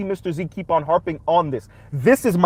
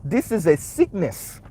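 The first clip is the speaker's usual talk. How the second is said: slow, put-on Haitian accent; slowed-down Haitian-accent imitation; 4 dB quieter, slower than usual. Shown as a demonstration. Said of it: "in a Haitian accent".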